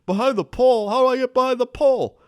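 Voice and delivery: high, loud baffled mock voice; confused driver. Retelling the confused driver's reply, high exasperated mock voice. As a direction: play the flustered driver, exasperated. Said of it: "he's like".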